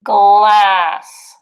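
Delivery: loud, drawn-out word; loud through the tube. Shown as a demonstration. Teacher calling a word into a tube for pre-K. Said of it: "say the word in your tube".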